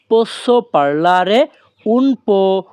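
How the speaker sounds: very slow, every syllable laid out clearly; slow teaching demo. Shown as a demonstration. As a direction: spell the phrase out slowly for learners. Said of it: "তখন আমাদের বলতে হবে কি". (ধীরে ধীরে স্পষ্ট করে বলা)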